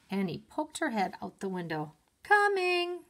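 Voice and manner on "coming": much higher, loud call back; shouting. A narrator's reading. Annie answers Mom again, shouting. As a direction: shout it out the window. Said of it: "she shouted".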